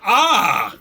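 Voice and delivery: high, loud "Ah!" of pain; sudden hammed-up cry of pain; pained yelp. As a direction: yelp as if you hurt your knee. Acting the hurt knee yelp.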